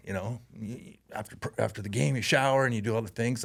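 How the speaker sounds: ordinary talk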